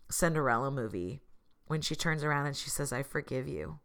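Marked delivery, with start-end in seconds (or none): 2.9-3.8 soft, humble forgiveness; sincere, not haughty. Retold or imitated. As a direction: say it softly and simply, without any haughtiness. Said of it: "she doesn't say it in a haughty way".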